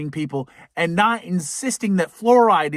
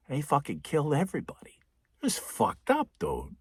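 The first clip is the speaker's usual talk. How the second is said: whisper; 3 semitones lower, 8 dB quieter than usual. Real, not acted. Hushed gruesome aside.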